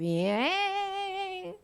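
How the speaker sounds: high drawn-out whizz; flying-away noise. Acted. Preacher mimics remembered words flying away, high whizzing sound. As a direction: imitate something whizzing off into the air.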